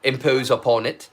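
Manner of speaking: put-on British English accent; politely proper British accent. Shown as a demonstration. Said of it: "It's fine with British accents".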